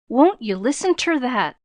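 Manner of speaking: a touch of newsboy scorn; scornful jibe. A narrator's reading. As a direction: jeer at her, scornful. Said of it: "jibed the scornful youth".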